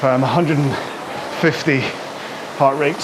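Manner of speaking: breathless while rowing; puffing between words mid-workout; out of breath. Real, not acted. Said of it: "Need to breathe".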